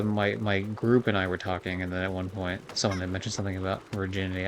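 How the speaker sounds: ordinary delivery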